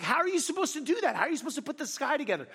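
high, fast frantic complaint; frantic exasperation. Retold or imitated. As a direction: rattle it off in mock frustration. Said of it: "I'm like".

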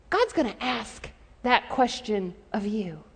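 usual speaking voice

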